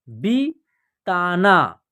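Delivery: बिताना drawn out, syllables apart, falling; slow syllable by syllable. Shown as a demonstration. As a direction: read बिताना slowly in parts. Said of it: "या आप direct भी पढ़ सकते हैं". (बिताना धीरे-धीरे टुकड़ों में)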